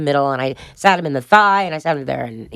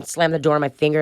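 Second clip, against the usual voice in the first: usual speaking voice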